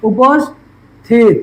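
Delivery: উপস্থিত said very slowly, pausing after উপস; slow syllable split. Shown as a demonstration. Teacher showing students where to pause in উপস্থিত. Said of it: "কোথায় কোথায় থাকছি".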